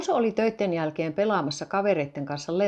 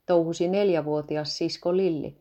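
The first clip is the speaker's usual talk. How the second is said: plain reading voice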